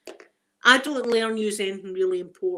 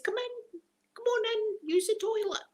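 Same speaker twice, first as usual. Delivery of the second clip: much higher, sugary hostess voice; mock sweet welcome; 13 semitones higher than usual. Mocking how she let the postman in, high and sweet. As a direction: trill the invitation like a naive host.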